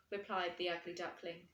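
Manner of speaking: plain reading voice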